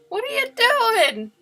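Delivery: high, loud, drawn-out question; incredulous protest. Real, not acted. Streamer protesting a donor's gift, incredulous. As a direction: wail it slowly in disbelief.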